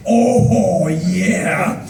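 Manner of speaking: loud, drawn-out satisfied exclamation; relishing. Retold or imitated. Coffee drinker tastes the good stuff, loudly relishing. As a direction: savor it with mock ecstasy.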